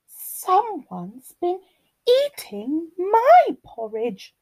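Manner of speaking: high, slow lilting mother bear; Mummy Bear. A narrator's reading. Mummy Bear finds her porridge eaten, high lilting complaint. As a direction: draw it out high as a put-out mama bear. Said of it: "rumbled Mummy's bear".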